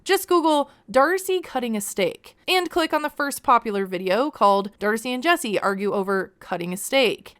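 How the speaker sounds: normal talking voice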